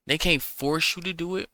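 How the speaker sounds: usual speaking voice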